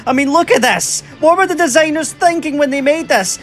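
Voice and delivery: high, disbelieving exclamations; incredulous. Real, not acted. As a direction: marvel at the absurd design. Mocking the oversized gun.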